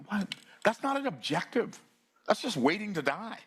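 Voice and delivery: normal talking voice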